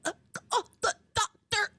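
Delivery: high, breathless struggling gasp; wheezing out the words; gasping old woman. Retold or imitated. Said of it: "but she's like".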